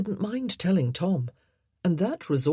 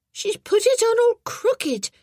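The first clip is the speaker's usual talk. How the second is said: put-on muffled boy voice; 6 dB louder than usual. A narrator's reading. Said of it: "said Geoffrey's muffled voice".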